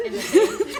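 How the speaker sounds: laughing. Real, not acted. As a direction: laugh as you tell it. Laughing telling Aston story.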